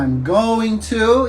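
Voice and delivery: each word of "I'm going to" drawn out slowly; slow careful demo. Shown as a demonstration. Teacher to students, showing the full form slowly. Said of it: "que é a junção de".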